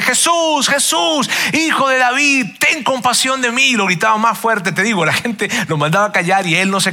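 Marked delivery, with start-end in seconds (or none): 0.0-3.7 higher, strained plea, barely louder; louder imitated cry. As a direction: cry out high above the crowd, pleading. (mendigo que grita más fuerte entre la gente, grito agudo)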